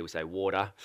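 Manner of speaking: "water" said the Australian way, softly; an easy Aussie "water"; quiet Australian "water". Shown as a demonstration. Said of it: "In Australia".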